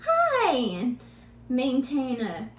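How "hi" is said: high, bright forced greeting; a strained cheery hi; forced cheerful hi. Retold or imitated. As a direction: fake a breezy hello to hide the worry. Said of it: "I'm trying to be like".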